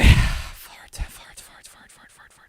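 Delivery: repeated whisper fading away; voiceless substitute swearing dying down; whispered cursing. Real, not acted. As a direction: whisper it over and over, trailing off.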